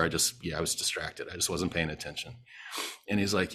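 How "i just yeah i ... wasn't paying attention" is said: sheepish admission. Retold or imitated. Admitting fault to the cop.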